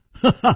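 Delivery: short, high "haha" laugh; acted chuckle. Chu Liuxiang laughing at his friend's question, acted chuckle. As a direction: give a light performed chuckle. Voiced character's laugh in dialogue.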